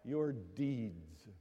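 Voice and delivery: drawn out, each word weighed; slow emphatic maxim. Real, not acted. Preacher telling the congregation a lesson, slow and deliberate. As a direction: lay it down slowly like a proverb. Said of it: "Believe me".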